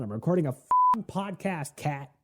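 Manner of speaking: high-pitched exasperated protest; exasperated scolding. Real, not acted. Podcaster telling off the cat for interrupting, exasperated and high. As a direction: pitch jumping up in exasperation at a pet.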